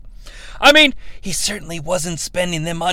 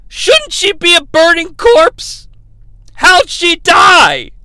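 incredulous; 9 semitones higher, 6 dB louder, slower than usual. Real, not acted. Mock outrage at the movie.

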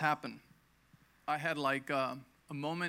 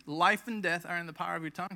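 ordinary talk, nothing marked

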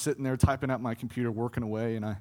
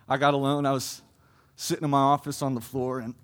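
normal talking voice

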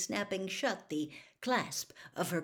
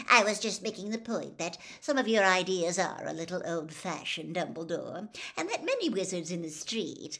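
louder, unabashed; defensive reporter; 5 dB louder than usual. Acted. Reporter unabashedly justifies calling the headmaster old-fashioned. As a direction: brush it off, no apology.

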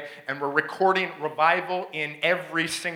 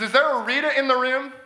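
prophet's call-out; 6 semitones higher than usual. Retold or imitated. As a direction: mimic the preacher's signature call into the crowd. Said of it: "that crazy thing that he does".

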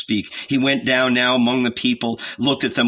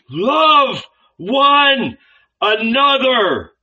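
slow, much higher, loud cry on LOVE; cry from the gut; 13 semitones higher, 6 dB louder, much slower than usual. A narrator's reading. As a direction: tear it out of yourself, drawn out. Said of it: "The cry escaped from his very bowels".

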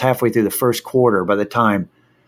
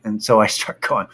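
ordinary talk, nothing marked

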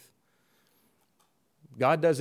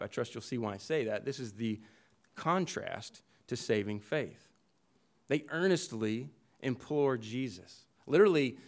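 ordinary talk

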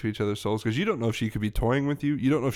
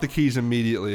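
ordinary talk, nothing marked

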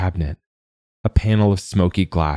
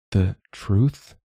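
put-on frightened girl questioning the god; hesitant question; 3 semitones higher than usual. A narrator's reading. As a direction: ask it timidly, half afraid of the answer. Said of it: "she asks hesitantly".

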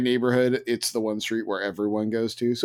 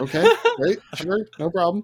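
strained agreeable parent; 9 semitones higher, 3 dB louder than usual. Retold or imitated. Parent giving in to changed costume.

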